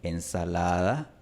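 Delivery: ensalada with a barely touched soft d; ensalada, just brush the d. Shown as a demonstration. Teacher demonstrating the approximant d, softly. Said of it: "una aproximante más suave".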